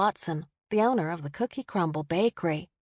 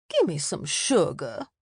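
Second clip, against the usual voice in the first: slower, lower fond coax; an older lady pulling him into a hug; lightly voiced matron; 3 semitones lower than usual. A narrator's reading. Mary Elizabeth voiced by narrator.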